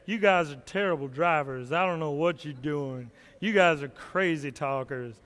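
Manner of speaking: low, deep gruff mock Barkley; Charles Barkley voice. [name] complains about bad drivers in a low Barkley voice. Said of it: "talk like Charles Barkley".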